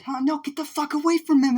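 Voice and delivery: very high, loud frantic order; alarmed shouting. Acted. Aizawa to student picking a fight, high alarmed yell. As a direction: yell the order at a squeaky height. Teacher orders him away.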